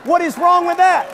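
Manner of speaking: high, slow and loud, over the laughter; indignant punchline defense. Real, not acted. Pastor to congregation, defending the joke, loud and high.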